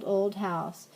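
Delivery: usual speaking voice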